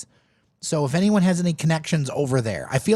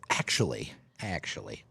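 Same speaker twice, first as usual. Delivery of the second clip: low confiding aside; 11 semitones lower, 8 dB quieter than usual. Real, not acted. Confessing tater tot preference.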